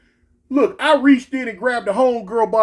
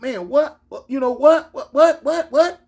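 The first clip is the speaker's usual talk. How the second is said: stammered, bewildered repetition; rising, clueless questioning; acted gawking; 4 semitones higher than usual. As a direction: imitate a dumbfounded crowd. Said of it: "like, gawking".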